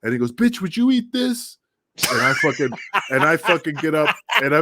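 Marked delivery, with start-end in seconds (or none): 0.3-1.6 higher, slow mocking taunt; voicing Jack. Storyteller imitating Jack taunting the girl, high and slow. 2.1-4.6 words broken by real laughter; laughing while telling. Real, not acted. Telling podcast hosts the story, laughing through the words.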